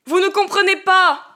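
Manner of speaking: high loud yell meant to sound firm; yelled, voice cracking. Acted. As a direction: try to sound resolute as the voice breaks. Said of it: "hurla-t-il avec une voix censée être ferme et résolue, qui se brisait". (hurlement qui se veut ferme mais se brise)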